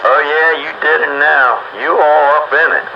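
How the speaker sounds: usual speaking voice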